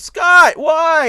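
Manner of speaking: high, loud wounded cry of his name; Jean catching him, betrayed and shouting; hurt Jean. Retold or imitated. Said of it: "Jean walks in and goes".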